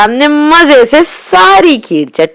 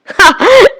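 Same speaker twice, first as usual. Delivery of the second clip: friends laughing; 8 semitones higher than usual. A narrator's reading. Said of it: "वो फिर हंसे".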